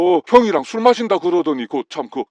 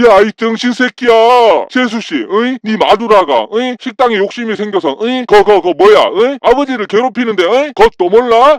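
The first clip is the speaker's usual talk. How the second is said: louder slurred rant, rushing in the middle; drunken yelling; 2 semitones higher, 4 dB louder than usual. Acted. Drunk brother yelling at younger brother about his wife, slurred and loud. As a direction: spit it out at him, drunk and exasperated.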